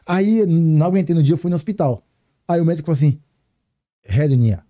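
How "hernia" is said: "Hernia" said the Japanese way, heru-nia with a tapped r and no Portuguese accent on hé. Shown as a demonstration. Patient quoting the Japanese doctor's word, Japanese "Hernia". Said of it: "em japonês é hernia".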